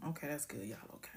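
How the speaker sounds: whispered aside. Real, not acted. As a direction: drop to a whisper. Muttered aside.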